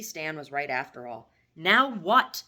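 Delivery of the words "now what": louder, sharp demanding question. Acted. Mother pressing her son for answers, demanding. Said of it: "she demanded".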